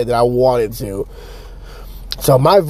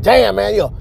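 mock complaint; 6 semitones higher, faster than usual. Voices friends' protest.

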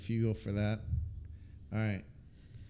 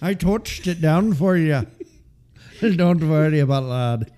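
put-on Irish accent; 4 semitones higher, 6 dB louder than usual. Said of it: "before you go into the Irish, lad".

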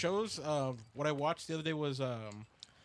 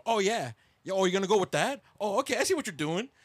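louder mock egging-on from the background; imitated heckling; 2 semitones higher, 6 dB louder than usual. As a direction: react loudly, as if watching someone show off. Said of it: "just hear him".

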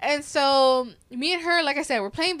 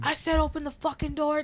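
retold angry demand; 5 semitones higher than usual. Retold intruder demanding to get in.

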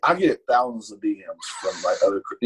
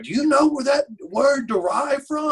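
retold scream; 12 semitones higher than usual. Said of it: "I'm talking screamed it".

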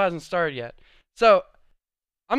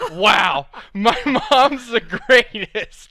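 laughing delight; 6 semitones higher, 10 dB louder, much slower than usual. Real, not acted. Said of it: "I'm like".

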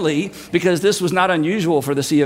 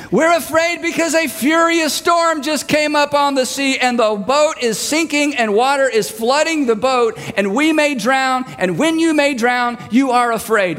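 exasperated yelling; 6 semitones higher than usual. Retold or imitated. Disciples answering Jesus over the storm, exasperated yelling. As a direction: shout back the obvious answer. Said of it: "What a silly question".

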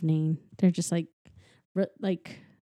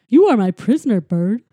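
louder goofy dog ordering; mock-stern dog bossing a bird; 3 semitones higher, 8 dB louder than usual. Dog holding bird prisoner.

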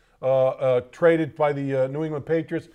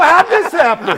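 laughing disbelief; 12 semitones higher, 10 dB louder than usual. Retold or imitated. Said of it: "I go".

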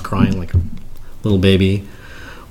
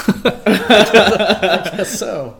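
drawn out slowly through a laugh, much higher; 12 semitones higher, much slower than usual. Real, not acted. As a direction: say it while still laughing. Laughs at unexpected question.

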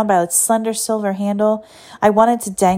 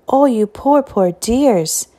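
put-on higher, fussing old teacher voice; old Miss Crossman; 4 semitones higher, slower than usual. Piano teacher greeting soaked girls, higher cooing voice. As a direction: coo over the wet girls. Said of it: "said old Miss Crossman".

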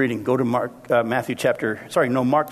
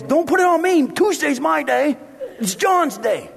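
defensive Peter; 11 semitones higher than usual. Retold or imitated. Preacher voicing Peter dodging blame in the boat, high and whiny. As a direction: pass the blame in a squeaky voice. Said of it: "Peter goes".

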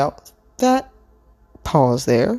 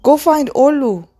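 high, louder urgent order; 12 semitones higher, 5 dB louder than usual. A narrator's reading. Chi-Chi sends Sunny to find Orlu, high urgent order. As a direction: send them off urgently, pitched way up.

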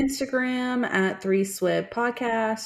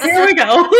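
higher, louder, laughing resignation; resigned laughing; 9 semitones higher, 8 dB louder than usual. Retold or imitated. Starting to cry at the wedding, a loud laughing resignation. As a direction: give in to the tears with a laugh. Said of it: "And I was like".

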